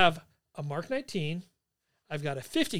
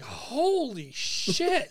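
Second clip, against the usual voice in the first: long, drawn-out higher-pitched awe; retold stunned reaction, stretched out slowly; stunned exclamation; 7 semitones higher, much slower than usual. Soldier seeing the blast behind him, stunned and drawn out.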